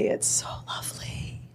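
soft breathless whisper; whispered awe. Real, not acted. Gushing about a romantic inn story, whispered. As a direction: hush it like something precious.

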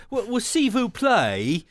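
mock a Brit mangling French; foreign accent in French. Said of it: "loses something when you say it like that".

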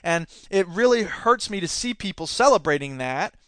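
ordinary talk, nothing marked

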